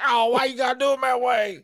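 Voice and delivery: very high-pitched, hammed-up impression; horrible Shannon Sharpe impersonation. Podcaster mimicking Shannon Sharpe reacting to a guest, badly impersonated. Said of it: "horrible Shannon Sharpe impersonation".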